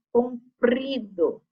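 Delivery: "Comprido" slow, the pr pushed; forced R. Shown as a demonstration. Teacher helping pupils notice the sound, forced R in "Comprido". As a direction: stretch "Comprido" and lean on its R. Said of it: "dando essa forçadinha no R".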